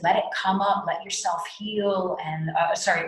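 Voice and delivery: ordinary talk, nothing marked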